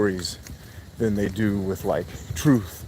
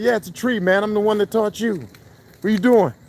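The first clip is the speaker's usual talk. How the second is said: exasperated dad; 11 semitones higher than usual. Retold or imitated. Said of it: "now he does it to us".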